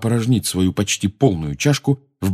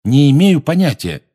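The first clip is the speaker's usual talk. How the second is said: louder, higher, defiant; loud denial; 7 semitones higher, 7 dB louder than usual. A narrator's reading. Harry refusing to tell Umbridge, loud. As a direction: fling the answer out loudly. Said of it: "громко сказал Гарри".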